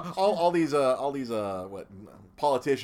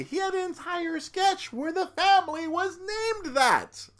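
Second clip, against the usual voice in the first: high-pitched indignation; 4 dB louder than usual. Retold or imitated. Protesting a double standard in sketches, high-pitched indignation. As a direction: draw out the outrage way up high.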